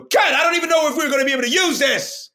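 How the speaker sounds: high, fast mock yell; fed-up director. Director finally gives up on the sketch, fast mock yell. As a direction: call cut in exasperation. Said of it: "the director just finally was like".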